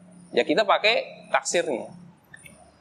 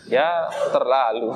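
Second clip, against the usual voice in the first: laughing; 8 semitones lower, slower than usual. Real, not acted.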